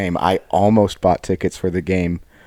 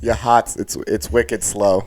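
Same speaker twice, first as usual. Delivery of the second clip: slow Boston surgeon delivering news; mock Boston accent; 3 semitones higher, 4 dB louder, slower than usual. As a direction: put on a joking Boston accent. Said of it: "if your heart surgeon was like".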